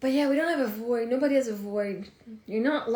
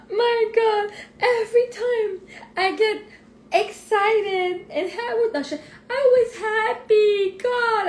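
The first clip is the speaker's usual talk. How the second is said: put-on higher, louder tearful wail of a hurt child; imitating whiny crying; 11 semitones higher, 7 dB louder, slower than usual. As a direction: whine through mock sobs about the finger. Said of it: "She's like".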